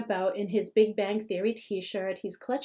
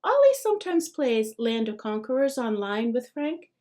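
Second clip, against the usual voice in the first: usual speaking voice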